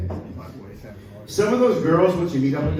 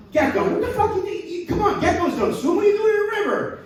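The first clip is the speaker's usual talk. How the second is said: very high, laughing cartoon voice, faster at end; incredulous gator. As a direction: scold him in disbelief. Gator scolds gecko.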